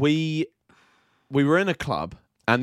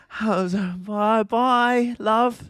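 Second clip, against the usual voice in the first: put-on higher, sing-song drunk farewell; cheery drunk goodbye; 6 semitones higher, much slower than usual. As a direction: wave off like a tipsy friend.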